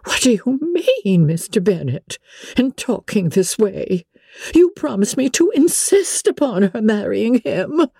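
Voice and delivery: high, reproachful mother's voice; a let-down wife scolding her husband, pitched high; Mrs Bennet, disappointed. Acted. Wife reproaches husband for going back on his word, high and aggrieved.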